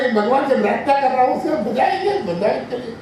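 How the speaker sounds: rushed, then a high fading voice on bajayenge; a light impression of the old musician answering. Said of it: "He would say".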